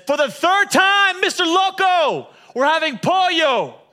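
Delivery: slow, high, loud shout; wife shouting back at her husband; fed-up wife shout. Retold or imitated. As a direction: spell it out, fed up. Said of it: "And she says".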